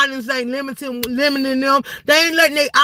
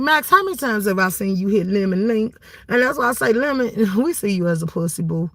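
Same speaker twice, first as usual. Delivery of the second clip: normal talking voice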